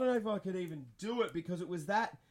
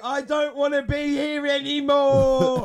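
scared whine, much louder and higher, slow; retelling his panic with a frightened voice; terrified; 6 semitones higher, 12 dB louder, slower than usual. Retold or imitated. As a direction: plead in fear. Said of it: "just fucking terrified".

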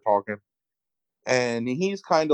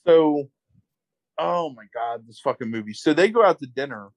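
ordinary delivery

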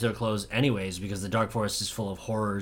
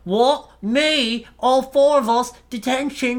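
high, loud squeal of outrage; mock outraged Draco squealing at the teacher; indignant disbelief; 12 semitones higher, 6 dB louder, slower than usual. Draco whining at McGonagall, high and loud. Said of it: "And he's like".

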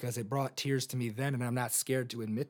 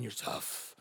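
whispered aside; 3 semitones lower, 9 dB quieter than usual. Retold or imitated. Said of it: "You're on top of the world".

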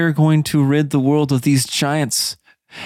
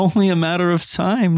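normal talking voice

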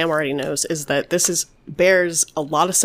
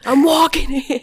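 put-on New York accent; 10 semitones higher, 5 dB louder than usual. Echoing New York accent joke.

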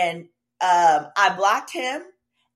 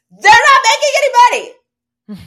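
sudden high yell; loud exclamation; 15 dB louder, faster than usual. Real, not acted. Telling a friend about the car, a high yell. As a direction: shout it out in disbelief.

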